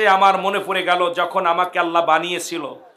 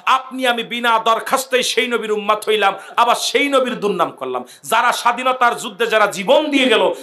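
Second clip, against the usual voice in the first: raised, high-pitched sermon oratory; impassioned preaching; 6 semitones higher than usual. Real, not acted. Preacher urging the crowd to honour the martyrs, impassioned preaching. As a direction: press each phrase on the crowd with rising fervour.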